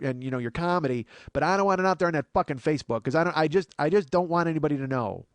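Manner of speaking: a lightly hammed-up scolding mother; imitating his mom.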